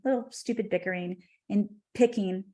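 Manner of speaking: ordinary talk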